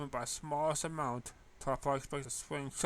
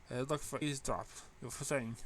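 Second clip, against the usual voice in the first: ordinary delivery, nothing marked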